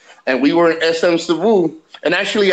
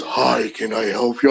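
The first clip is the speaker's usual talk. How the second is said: low, deep, quiet imitation of a booming bass voice; mock deep-voiced waitress greeting; 7 semitones lower, 4 dB quieter than usual.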